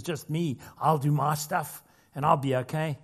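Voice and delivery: ordinary talk, nothing marked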